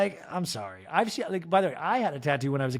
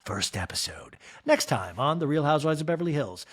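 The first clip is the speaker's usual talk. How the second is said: normal talking voice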